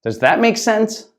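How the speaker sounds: "Does that make sense?" lifted high on make. Shown as a demonstration. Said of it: "depending on your intonation".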